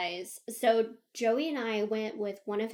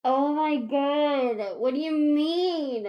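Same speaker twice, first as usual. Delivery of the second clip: loud, slow, drawn-out mock dismay; retold horror; 5 semitones higher, 7 dB louder, much slower than usual. Teller learns she spoiled the surprise, horrified. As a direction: stretch it out high, imitating shocked panic.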